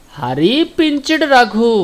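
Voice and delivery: slow, high-pitched, drawn-out answer; slow teaching answer. Shown as a demonstration. Teacher gives pupils the answer, slow and drawn out.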